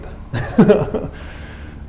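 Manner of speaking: a high breathy laugh that rises; a real laugh, mostly air; airy laugh. Real, not acted. Laughs after the reading.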